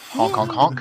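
goofy low honking; silly clown honks. Pretend. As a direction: honk like a clown horn. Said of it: "this song's for you".

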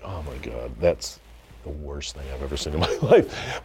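low, hushed aside of disbelief; quiet dismay. Retold or imitated. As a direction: mutter it low, as if privately appalled. Privately judging an actor's bad take.

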